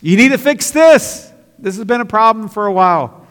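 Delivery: booming divine command; God yelling. Retold or imitated. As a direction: shout the rebuke down from heaven. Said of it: "it sounds like he's yelling to me".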